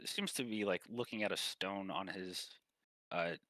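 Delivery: ordinary talk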